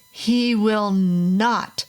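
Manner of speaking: father refusing the invitation, slow and hard; angry refusal. A narrator's reading. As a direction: shut the neighbor down flat.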